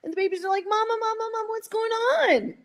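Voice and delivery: put-on high pleading mother and baby cow voices; voicing the cows. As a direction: plead like a cow calling for her calf. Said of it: "the mothers are over there going".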